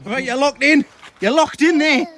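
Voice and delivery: loud, high and emphatic; mock-stern shouting. Real, not acted. Locked out by child with hose.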